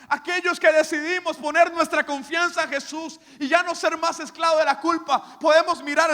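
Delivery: loud, high, drawn-out pulpit shout; preaching at full force. Acted. Pastor telling the church Jesus paid their debt, soaring shout. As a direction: lift it up, the grace is paid.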